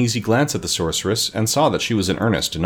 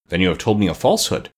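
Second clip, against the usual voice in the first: usual speaking voice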